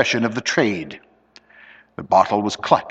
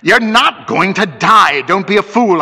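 loud, raised rebuke; impatient scolding; 9 semitones higher, 6 dB louder than usual. A narrator's reading. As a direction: scold him out of his panic. Scolding the panicking friend.

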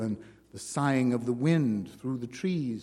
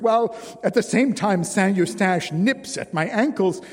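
higher and louder weary woman in mounting distress; Jeanne, rising distress; 5 semitones higher, 6 dB louder than usual. A narrator's reading. Jeanne describing her cruel daily march to Simon, higher and louder. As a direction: let her suffering spill out, louder.

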